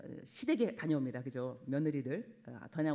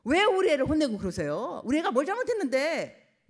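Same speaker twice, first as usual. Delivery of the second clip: louder, higher protest; a parent confronting the teacher; sharp, challenging complaint; indignant parent; 8 semitones higher, 8 dB louder than usual. Retold or imitated. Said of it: "이제 애를 혼냈어요".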